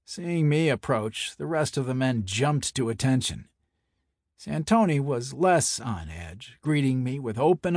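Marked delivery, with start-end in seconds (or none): none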